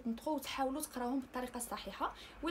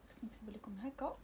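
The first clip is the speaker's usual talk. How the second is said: whisper; 2 semitones lower, 8 dB quieter than usual. Real, not acted. Whispered aside to the class.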